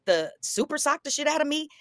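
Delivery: high-pitched incredulous reminder; retold taunt. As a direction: goad him, voice pitched well up.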